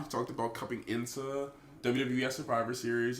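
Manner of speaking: ordinary talk, nothing marked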